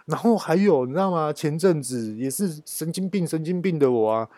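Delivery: normal talking voice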